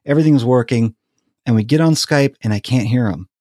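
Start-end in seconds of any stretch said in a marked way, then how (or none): none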